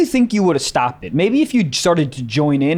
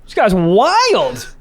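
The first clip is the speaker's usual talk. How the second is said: high, stretched-out stage delivery; mock stand-up comic; 7 semitones higher, slower than usual. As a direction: lightly play a comic working the crowd. Joins the mock stand-up routine.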